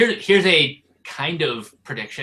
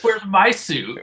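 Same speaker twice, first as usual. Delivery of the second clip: higher-pitched mock complaint; mock indignant demand; 6 semitones higher, slower than usual. Co-host chimes in as the suitless hero, mock indignant. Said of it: "in that exact tone".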